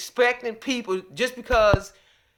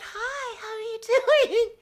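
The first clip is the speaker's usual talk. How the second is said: high, sugary greeting; overly polite front-desk voice; sweet customer-service voice; 13 semitones higher than usual. Retold or imitated.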